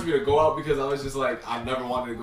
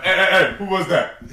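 higher, louder mock shout; mock theater yell; 5 semitones higher, 7 dB louder than usual. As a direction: pretend to call out in the dark cinema. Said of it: "I'd be like".

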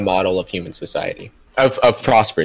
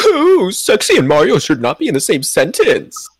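high cooing pigeon voice, pitched up; 9 semitones higher, 5 dB louder than usual. Acted. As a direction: coo it out like a pigeon. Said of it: "read Reese's comment in your pigeon voices".

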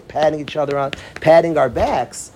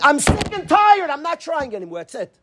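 exasperated quote; 9 semitones higher than usual. Retold or imitated. Counselor imitating a student who is sick of trying, exasperated. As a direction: voice a boy who has quit trying. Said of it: "and he described".

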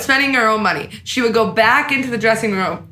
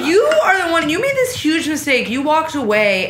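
exasperated, pitched-up telling-off; irritated retort; 7 semitones higher than usual. Retold or imitated. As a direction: snap back at her with irritation. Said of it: "I was just irritated with her response".

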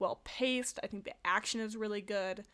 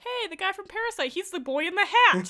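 high, loud, excited shout-out; excited mock quote; 10 semitones higher, 10 dB louder than usual. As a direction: imitate someone thrilled to spot a familiar face. Excitedly retelling others' recognition.

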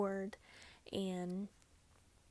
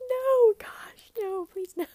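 much higher, loud pleading no; a loud high retold plea of no; emphatic refusal; 12 semitones higher, 13 dB louder, slower than usual. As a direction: cry out no no no, half laughing. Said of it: "And I'm like".

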